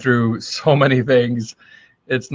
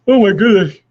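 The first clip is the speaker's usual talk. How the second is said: higher, louder words stretched through a real yawn; 8 semitones higher, 5 dB louder than usual. Real, not acted. Livestream host caught by an early-morning yawn, yawning. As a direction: talk through a big yawn. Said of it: "I apologize for yawning".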